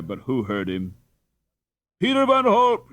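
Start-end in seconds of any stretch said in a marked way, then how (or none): 2.0-2.9 loud, high name called out; a hundred voices hailing their favorite; crowd shouting. Acted. Crowd hailing their favorite boy, loud high shout. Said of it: "shouted a hundred voices".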